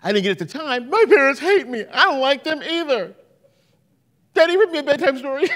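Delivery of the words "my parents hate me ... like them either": put-on louder whiny child complaining about parents; mock sulky kid. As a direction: ham up a pouting teenager. Mocking sulking child.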